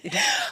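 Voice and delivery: burst of laughter. Real, not acted.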